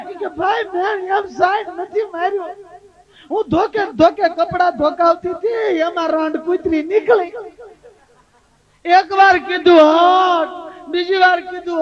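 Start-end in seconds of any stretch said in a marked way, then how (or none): 0.3-7.3 high, whiny, half-shouted tearful story; hammed-up weeping explanation; mock crying wife. 9.8-10.9 shooing dog. Retold or imitated.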